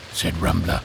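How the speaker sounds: plain reading voice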